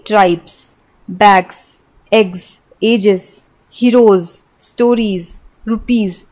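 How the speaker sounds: ट्राइब्स, बैग्स, एग्स end on a buzzing z sound. Shown as a demonstration. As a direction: let the final s buzz like z. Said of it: "उच्चारण ज होता है". (अंत में ज की ध्वनि)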